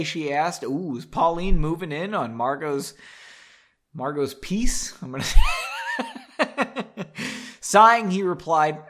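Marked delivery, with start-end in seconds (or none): 5.3-7.5 very high, loud shrieking laugh. Real, not acted. Bursts out mid-sentence.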